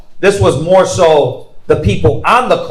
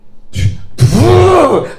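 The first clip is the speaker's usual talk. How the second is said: long puffed-out sigh, high then falling; exasperated huff; 9 semitones higher than usual. Acted. Grudging helper asked for a favor, heavy huff. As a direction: blow out a big put-upon sigh.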